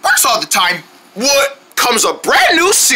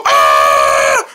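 low scream. Acted.

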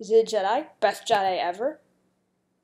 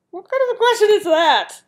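higher, louder baffled question; loud disbelieving rhetorical question; incredulous; 7 semitones higher, 7 dB louder than usual. Real, not acted. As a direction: sound thrown by a silly question. Baffled by an odd Akinator question.

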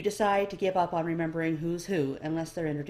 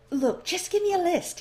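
much higher, louder impatient elf; 13 semitones higher, 6 dB louder than usual. A narrator's reading. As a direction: brush off the question, impatient. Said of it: "he says impatiently".

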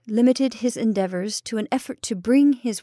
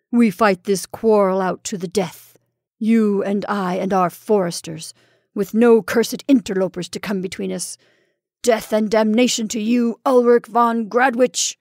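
loud, vicious declaration of war; snarling curse; 5 dB louder than usual. Acted. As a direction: curse him to the death. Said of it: "snarled George".

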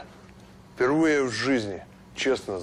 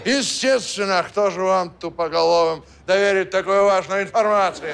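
stern officer voice; 7 semitones higher, 8 dB louder than usual. Acted. Kaban poses as a major.

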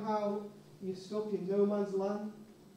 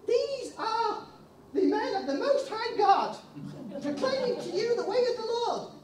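high, far-off proclaiming voice; proclaiming slave girl; 11 semitones higher, 4 dB louder than usual. Acted. Fortune teller proclaiming about Paul and Silas, high and strange.

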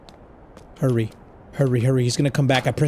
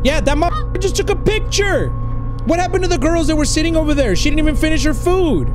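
alarmed shout; 9 semitones higher, 4 dB louder than usual. Acted. Startled friend spots the stalker.

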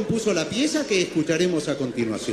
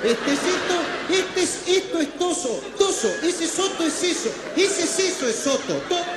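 high, mounting babble of muddled syllables; hammed-up verbal meltdown; tongue-tied frenzy; 8 semitones higher than usual. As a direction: get hopelessly tangled, pitch up, ending in a cry. Garbles closing line into frantic babble.

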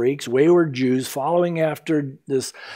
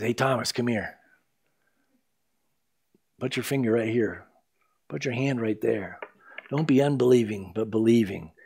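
soft and gentle; 5 semitones lower, 7 dB quieter than usual. Acted. Jesus speaks softly to Thomas.

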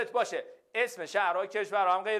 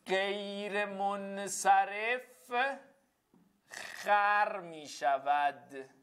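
very slow, stretched syllables; drawn-out mnemonic; 2 semitones higher, much slower than usual. Shown as a demonstration. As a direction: intone it slowly like a slogan to memorise. Teacher intones a memory phrase slowly.

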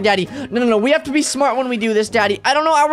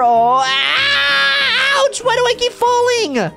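pained yelp; 9 semitones higher, much slower than usual. Real, not acted. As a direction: yelp from a hard fall. Boy falls again in dungeon.

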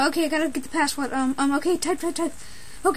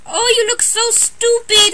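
slow, drawn-out taunt, a bit louder; mocking tease; 4 semitones higher, 5 dB louder, slower than usual. Peach teasing Mario over his new look, slow mock. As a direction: stretch it out to make fun of him.

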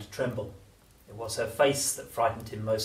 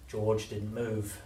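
ordinary delivery, nothing marked

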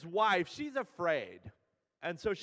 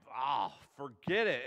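lower, mildly fed-up retold groan of defeat; mock exasperated surrender; 5 semitones lower than usual. Husband gives in to his crying wife, exasperated. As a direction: give up with an exasperated "oh", a touch lower.

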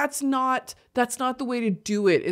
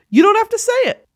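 high, louder: You don't have to say it; emphatic take; 12 semitones higher, 5 dB louder, faster than usual. Shown as a demonstration. High emphatic "You don't have to say it" to men.

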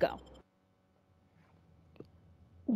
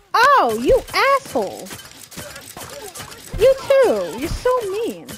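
angry shout; 10 semitones higher, 6 dB louder than usual. Real, not acted. Shot at by enemy.

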